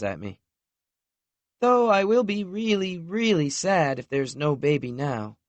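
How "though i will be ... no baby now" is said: higher voice for a wistful woman; a hint of a sad girlfriend; lightly voiced sad woman. A narrator's reading. Lexi admitting to Knox she would be sad, lightly voiced.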